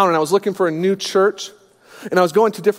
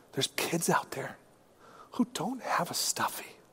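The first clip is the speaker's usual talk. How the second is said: soft whispered dad coaxing; gentle whisper; 12 dB quieter than usual. Retold or imitated. Dad whispering to his daughter about giving, soft and tender. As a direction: hush it like a tender father. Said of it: "spiritual dad moment".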